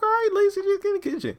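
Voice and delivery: very high put-on falsetto; mock urging. Host telling Lacey to obey, mock urging. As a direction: hurry her along in falsetto.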